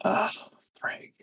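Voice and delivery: ordinary talk, nothing marked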